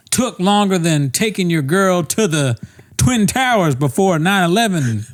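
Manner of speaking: high-pitched parody simile; mock host riff. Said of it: "that does sound like something he would say".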